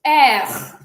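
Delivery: loud R rolled from the throat; French throat R. Shown as a demonstration. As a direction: push the R out from the throat like clearing a cough. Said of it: "from your throat".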